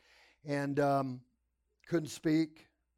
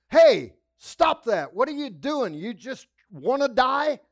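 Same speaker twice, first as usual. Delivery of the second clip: mean orderly's bark; 8 semitones higher, 12 dB louder than usual. Retold or imitated. Orderly scolding a restrained patient, loud and angry. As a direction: snap at the patient to quit it. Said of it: "but he was mean".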